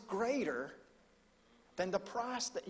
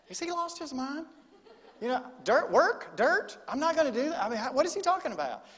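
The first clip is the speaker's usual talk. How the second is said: mock teens; 7 semitones higher, 5 dB louder than usual. Teens voiced reacting.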